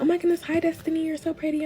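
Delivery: high, soft, sugary welcome; gushing nice parents. Retold or imitated. Boyfriend's parents greeting his girlfriend, gushing nicely. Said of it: "His parents are so nice".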